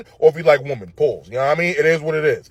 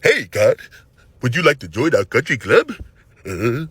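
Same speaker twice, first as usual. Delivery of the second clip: lower, talking like a mouth full of candy and a blocked nose; stuffed-up nose voice; 5 semitones lower than usual. Retold or imitated. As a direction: do the stuffy, uppity neighbor. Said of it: "He talk like he got a bunch of lemon heads in his mouth and his nose is stuffed up".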